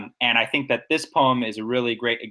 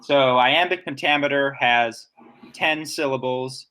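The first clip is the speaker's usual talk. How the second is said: normal talking voice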